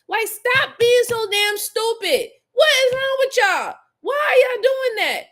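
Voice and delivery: high, loud scolding at the offenders; exasperated scolding. Real, not acted. Scolding true crime suspects, high and exasperated. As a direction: scold them, fed up and incredulous.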